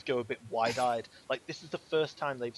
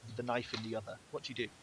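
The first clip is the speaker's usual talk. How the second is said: ordinary talk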